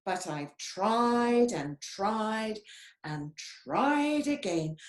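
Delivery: slow, dragged-out tried and tried; weary repetition. Acted. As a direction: lean on each tried like you're worn out. Chameleon frustrated at repeated trying.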